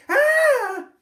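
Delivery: piercing, very high shriek; terrified scream. Acted. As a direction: shriek as the coat catches fire. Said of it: "and screamed".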